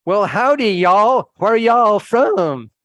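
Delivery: loud, high, drawn-out Texas drawl; hammed-up Texas accent, booming and stretched. Hotel owner's son greeting guests, in a loud mock Texas accent. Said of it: "and with a Texas accent".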